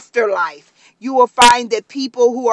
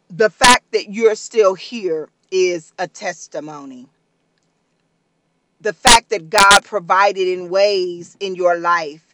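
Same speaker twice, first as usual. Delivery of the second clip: usual speaking voice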